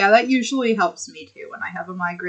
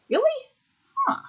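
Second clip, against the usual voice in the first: surprised; faster than usual. Real, not acted. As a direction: pitch shooting up in disbelief. Surprised at news.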